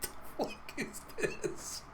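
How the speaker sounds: high, airy words through laughter; laughing in disbelief. Real, not acted. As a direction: barely get it out for laughing. Helpless laughter at absurd story turn.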